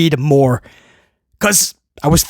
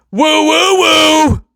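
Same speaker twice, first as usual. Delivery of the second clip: Zack Ryder woo; 8 semitones higher, much slower than usual. Retold or imitated. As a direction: belt out the woo woo woo like a wrestler hyping the crowd. Zack Ryder catchphrase impression.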